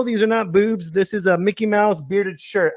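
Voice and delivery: normal talking voice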